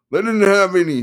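high, loud manic lawyer; excited manic attorney. Acted. Attorney voiced.

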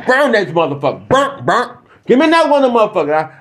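high and fast, giddy; gleeful burning. Acted. Executioner demanding the next one, high and fast. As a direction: rush it like you're loving it.

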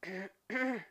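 a short gruff clearing of the throat. Acted. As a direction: clear your throat before telling the story. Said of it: "Der Schmied räusperte sich".

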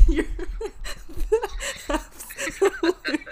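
high giggling disbelief; laughing. Real, not acted. Daughter laughing at her dad's voice on the phone. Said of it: "laughing at dad on phone".

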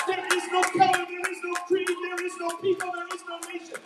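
ordinary talk

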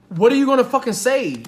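loud, high angry demand; acted outburst of scolding; ripping into him, imitated. Woman confronting date in the car, loud angry mock demand. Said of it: "she's ripping him a new one".

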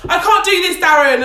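loud whiny celebrity complaint; hammed-up diva wail; Gemma Collins impression. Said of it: "she was like".

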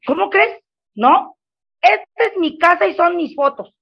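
high, indignant mock protest; mother brushing off her son's request, indignant; indignant retort. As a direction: scoff at the idea, pitch up.

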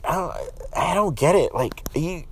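usual speaking voice

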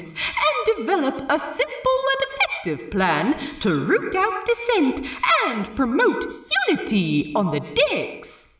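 high, bright sing-song sales pitch; grand ad read. Acted. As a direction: sell it like a hammy pirate advert. Jim reads ad aloud.

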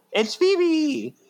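high, shocked gasp of a name; shocked cry. Acted. Sister finding her sister's hand, shocked cry.

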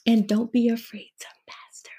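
hushed, secretive whisper. Real, not acted. Guest slips podcast host a cheeky tip, whispered. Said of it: "No, don't whisper that".

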